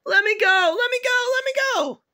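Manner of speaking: very high-pitched pleading yelp; squealed protest. Acted. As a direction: squeal like a kid being dragged off. Child being dragged away, protesting.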